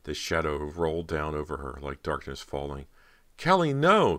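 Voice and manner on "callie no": high, loud desperate cry; frightened cry. Acted. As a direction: scream as the shadow swallows you. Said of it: "Cody managed to cry out".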